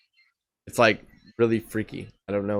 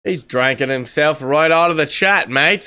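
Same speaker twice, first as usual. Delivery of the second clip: higher, lively Aussie commentary; Australian accent; 6 semitones higher, 3 dB louder than usual. Pretend. Host tells viewers co-host left chat, Australian accent.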